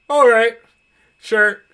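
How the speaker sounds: verbal shrug. Acted. Husband agrees to fight Baba Yaga, shrugging it off. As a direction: toss out a sure-why-not. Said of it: "in his usual yawn shrug".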